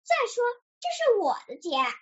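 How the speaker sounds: quick, high-pitched insistence; crying out. A narrator's reading. Duck claims the house, high petulant outcry. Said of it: "小鸭叫起来".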